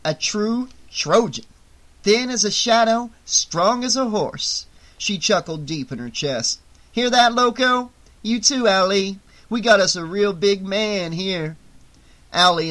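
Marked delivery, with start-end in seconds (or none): 0.1-4.6 higher-pitched, showy label reading; mocking read-aloud. A narrator's reading. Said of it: "read aloud from the covering". 6.9-11.6 high, loud jeer to cronies; higher-pitched, gleeful taunt; mocking. A narrator's reading. Woman mocks man to her cronies.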